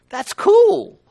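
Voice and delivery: very high, loud burst of enthusiasm; exultant delight. Real, not acted. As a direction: exclaim it with COOL held high and loud. Exulting over his point.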